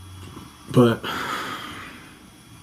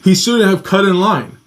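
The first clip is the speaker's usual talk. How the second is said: angry; 6 semitones higher than usual. Retold or imitated. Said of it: "The security guard stopped and went".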